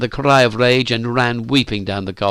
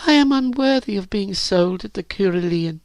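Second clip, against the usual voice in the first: put-on high, self-pitying girl's voice; dejected; 9 semitones higher than usual. A narrator's reading. Virginia drops her head at Phyllis's laugh, dejected. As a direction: say it high-pitched, head dropped. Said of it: "Virginia dropped her head".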